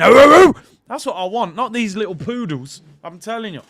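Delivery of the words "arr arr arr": loud, high imitated barks; dog barking. Fan imitates players coming out barking, loud barking. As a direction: bark like an angry dog. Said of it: "my players coming out barking".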